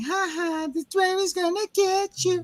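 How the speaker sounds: high sing-song mock menace; creepy taunting kid. Boy taunting his trapped sitter, high creepy sing-song. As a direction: tease it like a sneaky little brat. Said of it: "Jeremy's laughing".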